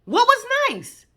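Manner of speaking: high outraged question; incredulous. Real, not acted. Reacting to the husband's reply, high and incredulous. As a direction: shoot it up in disbelief.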